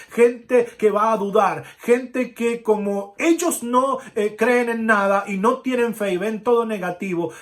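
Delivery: loud, high preaching at full force; fiery preaching. Acted. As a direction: preach it out to the whole church. (predicación enérgica y fuerte)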